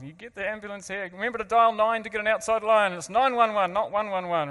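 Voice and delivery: calling out fast directions in a panic; urgent retold orders. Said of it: "and I was".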